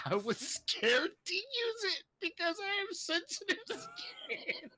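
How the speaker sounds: whispered through giggles; barely getting it out for laughing. Real, not acted. Reading review, laughing.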